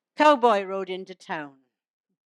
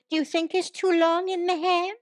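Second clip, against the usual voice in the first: higher-pitched wife's question; imitated wife in a changing room; wife asking; 8 semitones higher than usual. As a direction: lift it up like a wife wanting an opinion. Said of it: "no wife stepping out through a cubicle door with".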